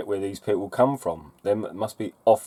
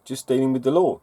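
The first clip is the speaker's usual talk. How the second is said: ordinary talk, nothing marked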